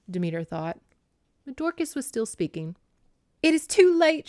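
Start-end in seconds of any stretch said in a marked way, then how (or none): 3.4-4.3 high, loud, urgent plea; insistent urging in a raised voice. A narrator's reading. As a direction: urge them on, pitched high. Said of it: "she urged".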